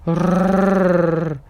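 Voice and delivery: puppy's loud growl; growling dog voice. A narrator's reading. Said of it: "и зарычал".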